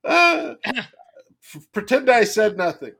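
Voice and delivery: laughing it off, loud; cackle, then plead through laughter. Real, not acted. Caught out, laughing.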